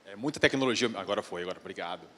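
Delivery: ordinary delivery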